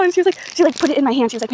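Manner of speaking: ordinary talk, nothing marked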